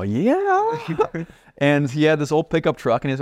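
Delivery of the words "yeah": much higher, drawn-out thrilled yeah; a long, delighted yeah; excited yes. Retold or imitated. Eager yes to the offer.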